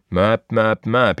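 loud, punchy honking noises; mock horn honk. Ex-courier mimicking the honking behind his van, loud mock honk. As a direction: imitate an impatient car horn. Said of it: "immer das dämliche Hupen im Rücken".

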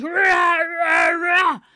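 loud, high growling roar; monster roar. Acted. Man-eater suddenly appears at the river, roaring. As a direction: roar out suddenly like an approaching ogre.

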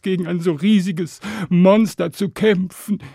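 high, loud wail of a despairing shepherd; lamenting shepherd. Acted. Shepherd bewailing the dragon's nightly thefts to Stan, lamenting. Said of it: "so sehr der Hirte auch jammerte".

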